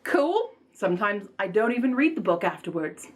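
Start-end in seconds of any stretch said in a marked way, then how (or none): 0.0-0.5 high, casual little reaction; mild mock surprise. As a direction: lightly toss off a pleased discovery, pitched up. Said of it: "And I go".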